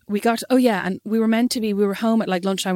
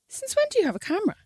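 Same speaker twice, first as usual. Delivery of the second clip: high-pitched, puzzled question; taken aback; 12 semitones higher, 4 dB quieter, faster than usual. Retold or imitated. Asking her boyfriend about the unexpected camera, taken aback. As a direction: ask it high, in disbelief at normal volume or softer. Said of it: "And I went".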